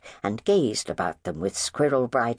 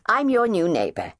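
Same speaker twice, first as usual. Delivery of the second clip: high, louder, smiling introduction; disarming neighbour; 10 semitones higher, 4 dB louder than usual. Acted. New neighbour introducing herself, smiling disarmingly. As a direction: charm them with a smile. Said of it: "smiling disarmingly".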